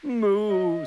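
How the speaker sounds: high, falling moo; cow's moo. A narrator's reading. Narrator voices the lonely cow.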